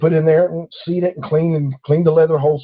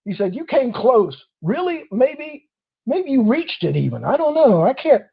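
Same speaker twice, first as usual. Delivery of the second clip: higher, chuckling officer voice; the officer letting him go, amused and higher; 7 semitones higher than usual. Retold or imitated. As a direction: play the cop half laughing.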